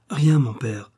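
usual speaking voice

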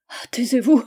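cri plus fort, suppliant; s'écrier. Acted. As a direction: faire taire Georges. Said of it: "s'écria-t-elle". (Charlotte supplie Georges de se taire, criant)

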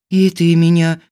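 plain reading voice

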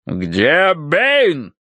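colonel's gruff demand; booming. A narrator's reading. Colonel asks the maid for the butler, booming. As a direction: boom out the question. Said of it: "пробасил полковник".

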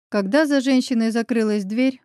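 plain reading voice

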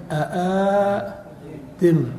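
أآدم slowly with both hamzas sounded; two hamzas stretched. Shown as a demonstration. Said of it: "الأصل". (مد أآدم ببطء مع نطق الهمزتين)

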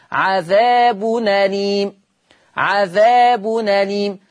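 slow recitation joining the nun to the hamza's fatha; naql demo. Shown as a demonstration. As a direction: say عذاب أليم as ʕaðabu-nalim, tanwin nun carried onto the open vowel. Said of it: "أفتح الفتحة دي".

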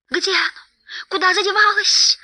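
high, frantic creature lament; panicked high wail; wailing Gollum. A narrator's reading. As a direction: cry out in a high distressed creature voice. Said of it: "бранился и причитал Голлум".

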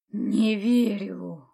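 slow, clipped hiss close to the ear; menacing hiss. A narrator's reading. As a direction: hiss it right into her ear. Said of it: "прошипел Блэк отрывисто".

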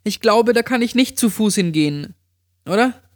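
high-pitched friend, unsure; Amy. A narrator's reading. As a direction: doubt it in a high voice, rising to a question. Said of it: "entgegnete sie".

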